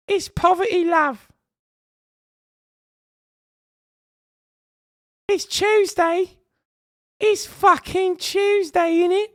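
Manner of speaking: loud falsetto British caricature; high-pitched British voice. Shown as a demonstration. Streamer doing the requested bit for chat, high-pitched British voice. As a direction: screech it very high with a British accent, drawn out. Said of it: "Please do the high pitch".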